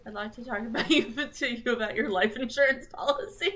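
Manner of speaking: quick, loud mock sales pitch; mock insurance salesperson. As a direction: pitch it fast and loud, half laughing.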